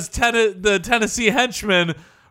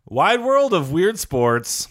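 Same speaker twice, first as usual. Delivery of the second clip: announcer voice; 3 semitones lower, slower than usual. Acted. Announcer title, slow.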